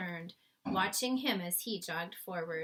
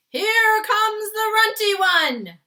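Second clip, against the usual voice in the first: huge, slow, sung-out boom, much higher and louder; booming giant; 14 semitones higher, 17 dB louder, slower than usual. A narrator's reading. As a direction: bellow it across the plain like a giant. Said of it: "boomed the flesh lump eater".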